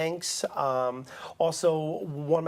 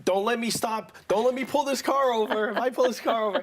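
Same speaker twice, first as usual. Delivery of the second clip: a hammed-up dad threatening from the driver's seat, pitched higher; mock angry dad; 6 semitones higher than usual. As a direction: play the fed-up father warning the kids in the back. Dad threatening kids in car.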